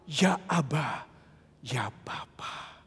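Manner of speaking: slow, soft whispered Abba Father; whispered, slow and devout; tender prayer. Acted. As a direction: fade into an intimate whisper of prayer. Intimate prayer voice.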